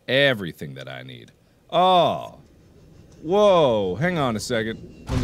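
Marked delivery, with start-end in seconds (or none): 0.1-1.3 much lower, deep repeated phrase; deep echo. Shown as a demonstration. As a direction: drop the voice low for the echo.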